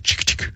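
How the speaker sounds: imitating a man's quiet "chick, chick" tic; two quick clicked chicks, softly; tongue-click sentence ending. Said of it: "end his sentences with".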